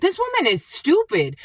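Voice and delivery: high-pitched disbelief; retold exasperation. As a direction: act out being fed up with someone. Retells exasperation at the woman.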